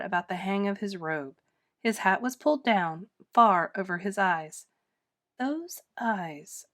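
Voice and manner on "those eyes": soft suspicious muttering. Acted. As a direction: mutter it to yourself, much softer. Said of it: "muttered Droon".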